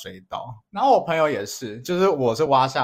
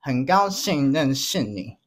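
put-on slow, polite Japanese-accented Mandarin; slower than usual. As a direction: greet like a Japanese newcomer. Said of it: "来，请开始".

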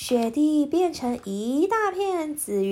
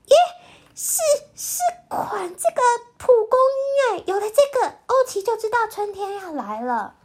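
put-on excited child voice; 10 semitones higher, 3 dB louder, faster than usual. Jisaiya excited at dandelion.

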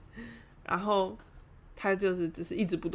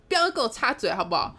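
louder, higher mock telling-off; fierce scolding; 4 semitones higher, 6 dB louder than usual. Friend telling speaker not to interrupt, fierce scolding. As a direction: snap it like a bossy sister. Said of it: "他姐凶说".

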